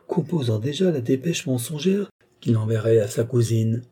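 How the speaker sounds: ordinary delivery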